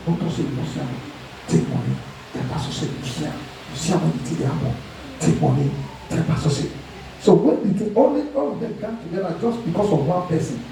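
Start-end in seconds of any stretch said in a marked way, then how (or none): 0.1-6.7 low, hurried undertone, partly voiceless; hushed coaching. Acted. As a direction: coach a false witness on the sly, low and hushed. Secretly coaching a paid false witness.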